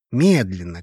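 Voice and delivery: ordinary delivery, nothing marked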